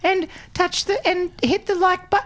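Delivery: put-on very high, loud kid gamer voice; kid YouTuber voice. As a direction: play an eager kid YouTuber.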